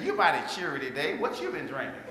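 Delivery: low, teasing cafeteria lady. Retold or imitated. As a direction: rib him playfully. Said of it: "She said".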